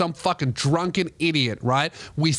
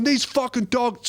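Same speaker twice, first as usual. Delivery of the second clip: mild mock yell; 6 semitones higher than usual. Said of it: "screaming shit".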